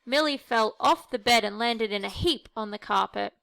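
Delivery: ordinary delivery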